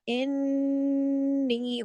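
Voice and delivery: इन्नी with the nun hummed very long through the nose, very slow; long ghunna. Shown as a demonstration. Teacher demonstrates a long ghunna, very slow. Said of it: "काफी बड़ा गुन्ना होगा".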